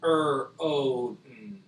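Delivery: "Er, O, mm" sound by sound. Shown as a demonstration. Teacher blends throne, sounded out. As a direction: stretch "Er" and "O", drop to a soft "mm". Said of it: "the sound stops there".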